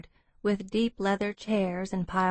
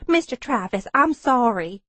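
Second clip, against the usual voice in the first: higher, louder apologetic receptionist; flustered apology; 3 semitones higher, 4 dB louder than usual. A narrator's reading. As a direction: apologize to the boss, a touch flustered.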